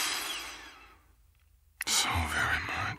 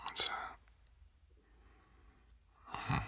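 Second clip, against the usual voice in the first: hushed curious whisper; 12 dB quieter, slower than usual. Acted. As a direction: whisper the question softly. Checking on feverish partner quietly.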